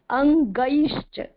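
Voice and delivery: slow अंगैश्च with ऐ as अ gliding to ई; pron of अंगैश्च. Shown as a demonstration. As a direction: stretch the ऐ of अंगैश्च as अ-ई. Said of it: "अ और ई mix करके बोलेंगे". (अंगैश्च धीरे, ऐ को अ-ई मिलाकर)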